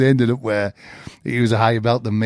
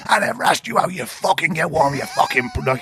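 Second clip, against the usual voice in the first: mock furious rant at the visitor, pitched up; retold angry outburst; 7 semitones higher than usual. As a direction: snap it out like a raging coach. Angry coach shouts at visitor.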